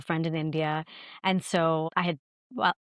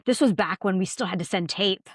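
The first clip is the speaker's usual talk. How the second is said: usual speaking voice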